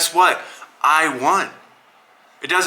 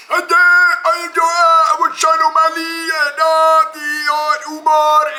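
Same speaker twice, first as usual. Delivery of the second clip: much higher and louder mocking put-on voice; hammed-up high-pitched caricature yelling; Merab voice; 13 semitones higher, 4 dB louder than usual. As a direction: shout it way up high, mocking a rival fighter. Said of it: "that's how he sounds".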